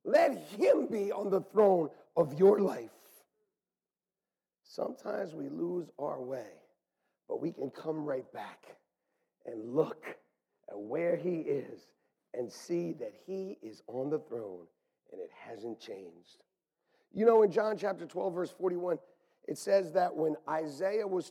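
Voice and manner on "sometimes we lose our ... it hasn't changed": softer, reflective. Real, not acted. Soft reflective turn.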